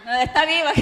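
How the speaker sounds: laughing. Real, not acted. Joking aside in sad testimony.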